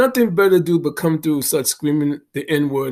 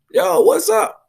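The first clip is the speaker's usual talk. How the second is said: retold yelled greeting; slower than usual. Big guy yelling a greeting at him downtown, high mock yell. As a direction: belt it out like a stranger hollering across the street. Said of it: "he started screaming".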